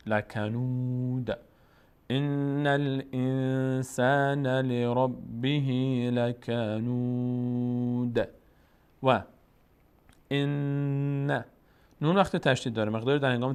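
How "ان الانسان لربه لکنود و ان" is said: slow, drawn-out chanted Arabic recitation; slow Quran recitation. Shown as a demonstration. Teacher slowly reciting the verse for the student, chanted. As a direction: stretch every syllable as in tajweed. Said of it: "teacher recites the full verse".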